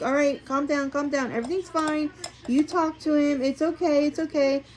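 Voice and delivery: high gentle calming voice; soothing. Retold or imitated. Kind coordinator hugging a crying vendor, soothing.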